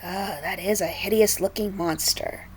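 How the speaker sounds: plain reading voice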